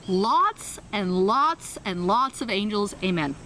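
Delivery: very slow, dragging "lots and lots"; slow stumbling amen; slow halting prayer. Retold or imitated. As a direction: stretch out the few words you know. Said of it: "This is literally how it went".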